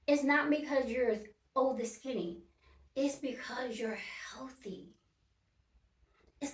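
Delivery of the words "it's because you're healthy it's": soft unvoiced whisper, leaning in. Real, not acted. Whispering to viewers that health matters, not skinniness. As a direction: whisper it like a secret truth.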